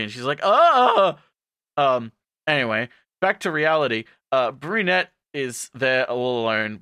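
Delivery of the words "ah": high, loud "ah"; hammed-up startled yell; mock scream. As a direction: cry out in acted fright. Acting scream at zombie dog.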